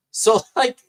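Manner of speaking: laughing. Real, not acted. Laughing at Pelosi's claim.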